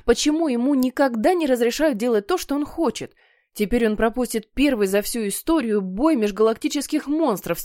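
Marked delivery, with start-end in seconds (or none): none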